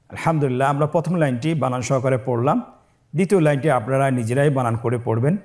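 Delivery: ordinary talk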